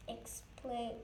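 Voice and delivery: usual speaking voice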